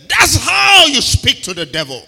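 loud, slow shouted declaration; preacher quoting God boldly; authoritative shout. Retold or imitated. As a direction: proclaim it forcefully. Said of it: "Smith Wigglesworth said".

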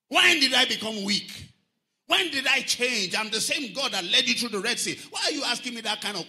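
loud, high, forceful rebuke; indignant challenge. Acted. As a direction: thunder the questions at a doubter. God rebukes doubting Moses, acted.

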